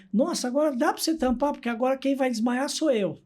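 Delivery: a hint of the squeamish clown; mock-faint clown. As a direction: fake being about to faint, playfully. Clown jokes he will faint.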